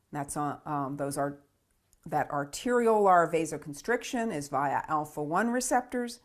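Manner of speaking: ordinary delivery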